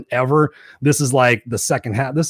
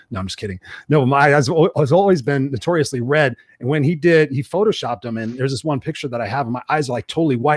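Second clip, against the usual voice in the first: ordinary talk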